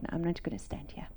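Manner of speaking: soft whispered vow; whispered resolve. Retold or imitated. Beside her husband at the deathbed, a whispered vow. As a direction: whisper it firmly to yourself.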